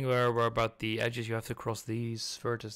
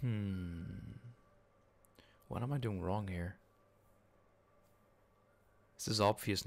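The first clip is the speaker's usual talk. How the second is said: normal talking voice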